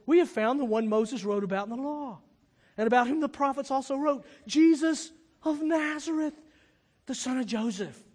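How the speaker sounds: high, loud eager proclamation; excited announcement. Retold or imitated. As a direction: act out breaking big news to a friend. Acts out Philip's excited announcement.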